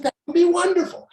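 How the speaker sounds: put-on light up like a charmed older lady accepting lunch; a touch of a thrilled older woman; delighted older lady.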